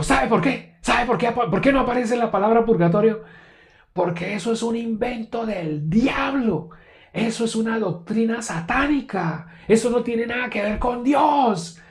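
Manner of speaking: imitating a furious preacher's yell; angry shouting. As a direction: rant it down at him, way up high. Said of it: "él me gritaba".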